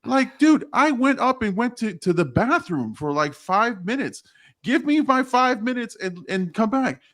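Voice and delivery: venting at HR, asking for his five minutes; indignant complaint. Retold or imitated.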